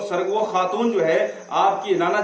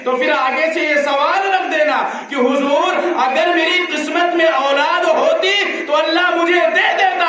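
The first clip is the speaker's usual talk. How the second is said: building from firm speech to a high, loud shout; preaching shout; 11 semitones higher, 6 dB louder than usual. Acted. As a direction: rise to a full preacher's cry. (واعظ کا بلند ہوتا ہوا نعرہ، بہت اونچی آواز میں)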